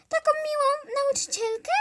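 high, loud shocked question; shocked disbelief. Acted. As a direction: gasp it in disbelief. Kid shocked.

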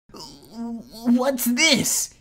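can't believe his eyes; incredulous. Acted. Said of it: "he asked incredulously".

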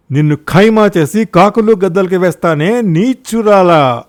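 enraged husband shouting threats at his wife; furious shouting. Acted. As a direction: roar at her, shaking with anger. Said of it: "కోపంతో వణికాడు".

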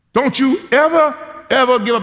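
put-on high, loud child insisting on ever; a five-year-old laying down the law; little girl shouting up at grandpa, high and fierce; bossy little girl.

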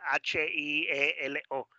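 letter by letter, slowly; slow classroom spelling. Shown as a demonstration. Teacher spelling out a word.

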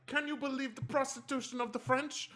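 very high, mock scandalised question; indignant French woman. To the narrator at the table, an imitated French woman, high and scandalised. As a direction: ham up her disbelief at the narrator. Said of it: "she said something to me like".

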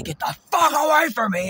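high, loud shout, slightly held back; reenacted scream. Replaying how he chased off an aggressive stranger, screaming. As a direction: scream it like you're driving someone off. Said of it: "And I scream it".

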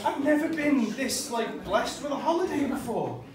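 high giddy disbelief. Retold or imitated. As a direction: squeal in happy wonder. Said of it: "I'm like".

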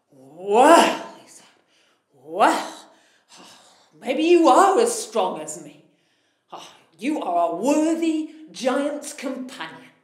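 a huge giant won over by a small man; impressed giant. Acted. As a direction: giant inviting the tailor to dinner. Amazed giant invites tailor.

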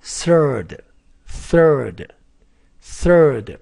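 third drawn out very slowly, IR as er; slow model word. Shown as a demonstration. English teacher modelling third for students, very slow and drawn out. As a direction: say third slowly, stretching the er sound. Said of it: "这个I R发".

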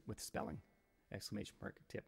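under the breath, unvoiced; whispered aside. Streamer mocks viewers' spelling in a whisper. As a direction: slip the jab in under your breath.

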